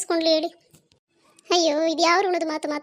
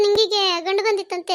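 ordinary talk

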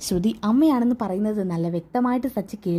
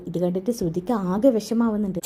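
plain reading voice

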